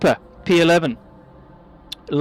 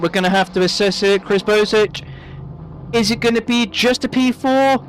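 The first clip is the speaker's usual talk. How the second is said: ordinary talk, nothing marked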